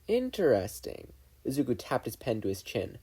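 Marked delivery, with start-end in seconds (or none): 0.1-1.1 pondering. A narrator's reading. Boy pondering.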